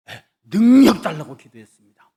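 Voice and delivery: proud, loud reply to the professor; a brash student answering his teacher, loud; loud bold answer. Retold or imitated.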